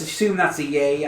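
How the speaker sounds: ordinary delivery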